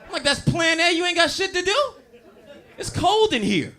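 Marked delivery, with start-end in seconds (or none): 0.0-1.9 mock disbelief. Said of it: "I said".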